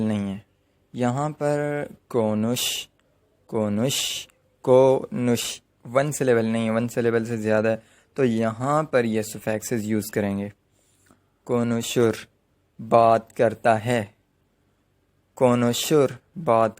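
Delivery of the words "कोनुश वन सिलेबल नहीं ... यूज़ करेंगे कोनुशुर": ordinary talk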